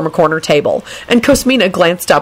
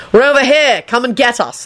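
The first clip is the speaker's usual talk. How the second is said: higher, mild mock shout; quoted shout; 7 semitones higher than usual. A narrator's reading. Man retells a friend calling to enemies, mock shout. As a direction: call out without full volume. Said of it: "Remus stood up and shouted".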